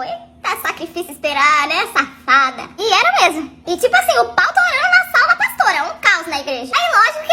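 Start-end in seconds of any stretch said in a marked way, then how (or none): 0.0-2.7 low teasing pastor. Retold or imitated.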